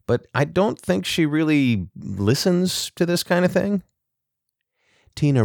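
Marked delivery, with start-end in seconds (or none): 0.1-3.8 higher, tentative Kyle voice; uncertain. A narrator's reading. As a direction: sound unsure, pitched up high. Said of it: "Kyle said uncertainly".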